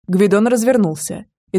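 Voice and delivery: plain reading voice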